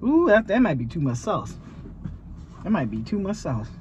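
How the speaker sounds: normal talking voice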